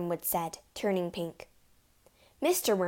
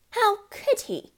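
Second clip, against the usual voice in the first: mother's voice; 14 semitones higher, 8 dB louder, slower than usual. A narrator's reading. Mother voice in story.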